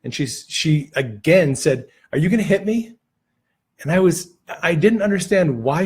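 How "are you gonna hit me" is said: fearful question. Retold or imitated. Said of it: "she was very fearful".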